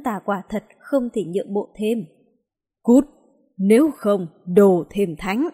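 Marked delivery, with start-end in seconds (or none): 2.8-5.5 thunderous roar, slow and loud; booming thunder and wind. A narrator's reading. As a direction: boom it out like thunder. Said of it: "Giọng nói như sấm vang lên".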